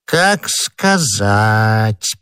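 very slow, stretched evasive answer; drawling, noncommittal; drawn-out reply. Acted. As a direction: stretch out each word, hedging. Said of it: "протянул Бонасье".

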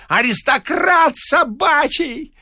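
high, slow sneering yell; a janitor jeering at the locksmith before a crowd; mocking shriek. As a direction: shriek the title as an insult. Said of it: "вскрикивал дворник".

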